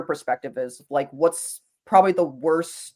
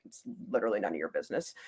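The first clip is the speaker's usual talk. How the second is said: low, quick retort under the breath; very soft, low, hurried brush-off; muttered retort; 7 semitones lower, 12 dB quieter, much faster than usual. Retold or imitated. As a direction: mutter a dismissive comeback, fast and low. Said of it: "I'm like".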